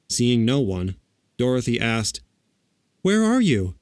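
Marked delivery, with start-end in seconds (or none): 3.0-3.7 high, calling out to the air; small girl calling; young girl voice. A narrator's reading. Dorothy calls out.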